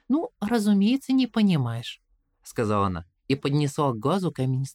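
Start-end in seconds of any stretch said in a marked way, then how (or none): 0.1-2.0 put-on worried young girl, higher; anxious little girl asking; girl's voice. A narrator's reading. Caroline retorts. (голос встревоженной девочки)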